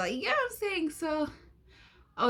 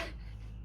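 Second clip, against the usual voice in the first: short breathy laugh; 18 dB quieter than usual. Real, not acted. Laughs at her own shock.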